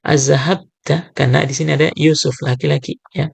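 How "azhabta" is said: Azhabta drawn out slowly; slow model word. Shown as a demonstration. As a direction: stretch Azhabta out for the class.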